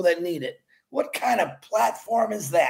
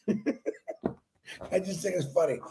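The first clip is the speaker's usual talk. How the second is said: slow, softer, real chuckle through it; laughing softly; 3 semitones lower, 7 dB quieter, much slower than usual. Real, not acted. To viewers about Peter Shore, laughing softly. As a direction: chuckle the line out quietly.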